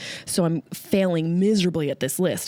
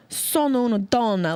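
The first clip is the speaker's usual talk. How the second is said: mock Italian. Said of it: "You ever listen to Italians?".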